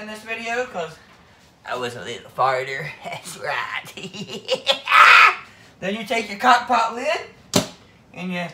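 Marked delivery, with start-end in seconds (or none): 4.0-5.4 loud, high burst of laughter; shouted cackle. Real, not acted. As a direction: crack up at your own joke. Laughing at his own joke.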